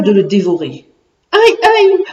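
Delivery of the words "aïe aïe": high, loud storybook yelp of pain; cri de douleur. A narrator's reading. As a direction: cry out in acted pain and fear. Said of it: "hurla de douleur et de peur". (cri de douleur et de peur, joué)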